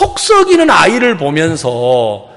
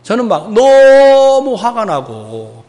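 normal talking voice

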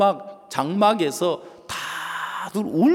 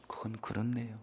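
whispered agreement; 10 semitones lower, 20 dB quieter, faster than usual. Retold or imitated. Calmed congregant agrees.

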